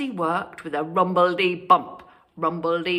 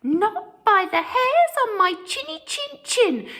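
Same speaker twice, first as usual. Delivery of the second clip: high, louder defiant piggy yell; 5 dB louder than usual. Acted. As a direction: yell back at the wolf. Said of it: "yelled Horace".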